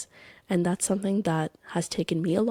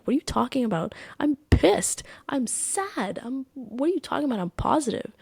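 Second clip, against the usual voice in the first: higher, half-whispered mock protest; replaying her annoyed disbelief, breathy and raised; retold indignant outburst; 6 semitones higher than usual. To her therapist, retelling her reaction: mock indignant rant. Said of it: "like, I was mad".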